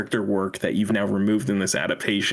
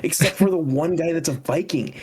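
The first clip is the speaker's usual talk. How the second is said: mocking high voice; 7 semitones higher than usual. Ranting in a raised mocking voice.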